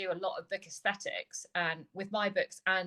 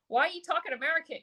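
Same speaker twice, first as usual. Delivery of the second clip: put-on quick, higher puzzled kid voice; mock a puzzled child, higher and faster; kids asking; 9 semitones higher, 5 dB louder, faster than usual. Kids quizzing her about her American accent, higher, faster and puzzled. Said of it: "the kids will look at me and go like".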